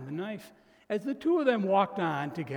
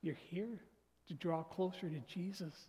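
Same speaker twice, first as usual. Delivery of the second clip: hushed; 9 dB quieter than usual. Real, not acted. Sermon point, voice drops.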